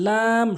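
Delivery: "লাম" with a long held aa; correct pronunciation. Shown as a demonstration. Teacher models the Arabic letter, long "লাম". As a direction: draw out "লাম" evenly. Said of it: "সঠিক উচ্চারণ".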